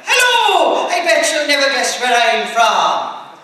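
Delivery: high, loud stage-Irish holler; fake Irish accent. Wannabe Irishman greeting night-shift worker, loud fake Irish accent. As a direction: boast in a hammed-up Irish brogue, slowing down. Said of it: "desperately wished he was Irish".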